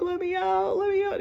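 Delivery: very high-pitched mock panic; imitated screaming. Child locked in the basement, high imitated scream to get out. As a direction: squeal it high, re-enacting the terror. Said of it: "I was screaming".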